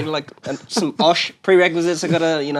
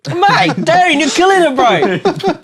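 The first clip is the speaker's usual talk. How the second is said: excited; 9 semitones higher, 3 dB louder, much slower than usual. Real, not acted. Cheering friend on correct answer.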